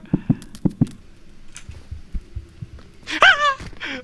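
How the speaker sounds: breathless high-pitched nervous laugh; laughing off the fright; shaky high laugh. Real, not acted. Laughing after the scare.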